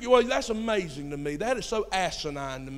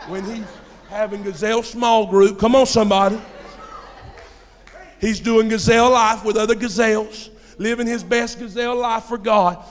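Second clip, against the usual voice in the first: loud, rousing sermon delivery; shouted church exhortation; full-force preaching; 3 semitones higher, 9 dB louder than usual. Real, not acted. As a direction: belt it out to stir the congregation.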